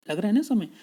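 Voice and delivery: ordinary talk, nothing marked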